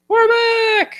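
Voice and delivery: very high-pitched squeal of return; squeaky high comeback cry; squealed announcement. Pretend. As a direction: announce it in a tiny, sky-high voice. Hosts announce return after break.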